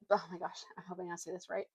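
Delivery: usual speaking voice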